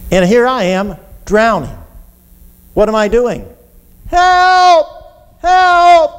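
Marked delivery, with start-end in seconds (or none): 4.1-6.1 high, loud, drawn-out cries; yelling for help. Acted. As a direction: scream for rescue as if drowning. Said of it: "I'm still yelling, screaming".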